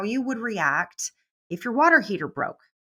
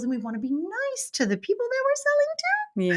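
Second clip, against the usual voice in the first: much higher, pleading sweetness; sugary sales voice. Retold or imitated. Coach parodying timid saleswomen, high pleading voice. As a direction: beg them nicely to buy.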